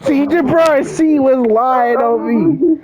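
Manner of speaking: high-pitched laughing protest. Real, not acted. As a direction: laugh while calling out the lie. Laughing about the lie.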